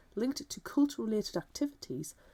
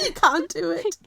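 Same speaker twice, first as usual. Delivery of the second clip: much higher and louder teasing call; 9 semitones higher, 9 dB louder than usual. Pretend. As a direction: tease them loudly. Teasing the cohost, two contrasting takes.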